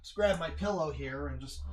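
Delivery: normal talking voice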